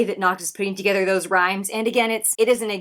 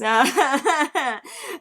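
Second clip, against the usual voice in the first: higher-pitched happy laughter; delighted laugh; 5 semitones higher than usual. Real, not acted. Overjoyed reaction to the song.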